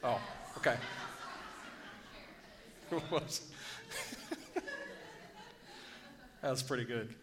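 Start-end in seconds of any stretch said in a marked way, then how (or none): 2.9-4.9 a high rising airy giggle; high breathy giggle. Real, not acted. Preacher giggling at his own joke, soft high giggle. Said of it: "I like my own jokes".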